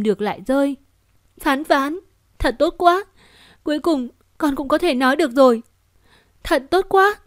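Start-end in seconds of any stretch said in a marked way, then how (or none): 1.4-7.1 high, loud tearful joy; overjoyed. Acted. Mother overjoyed her child can talk, high joyful cry. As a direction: burst with relief and happiness.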